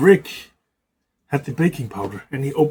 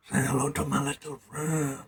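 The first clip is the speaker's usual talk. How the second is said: Scarface gangster line, much softer and mumbled; quiet Scarface impression; 8 dB quieter than usual. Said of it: "This is like I'm in Scarface".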